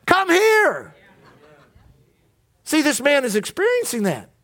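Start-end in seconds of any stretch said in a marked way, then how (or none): 0.1-0.9 1 inviting call from heaven. Pretend. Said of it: "What would it be from heaven?".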